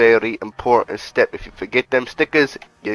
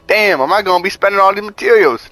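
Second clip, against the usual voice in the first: voicing the player's worry in a raised put-on voice; mock worried viewer; 7 semitones higher than usual. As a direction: play the nervous viewer asking the question. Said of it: "Now you might be worried".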